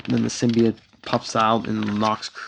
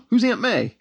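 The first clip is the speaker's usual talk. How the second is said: confused question; 11 semitones higher than usual. Retold or imitated. Deadpool waking confused.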